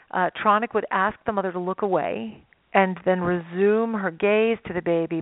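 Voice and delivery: ordinary talk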